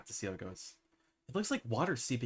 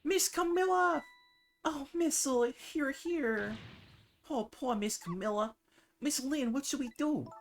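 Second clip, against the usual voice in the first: much higher, eager little voice; high small dog voice; slower than usual. Acted. Missile the dog fretting over Camilla, high eager voice. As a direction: chirp it like a worried puppy.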